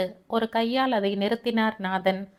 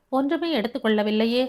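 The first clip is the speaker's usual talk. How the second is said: plain reading voice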